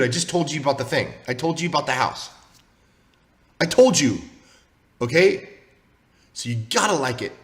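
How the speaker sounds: normal talking voice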